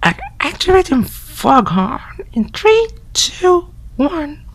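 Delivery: put-on high, hushed, whispered girly voice; girl voice whispering. Friend whispering spooky morgue facts through the door.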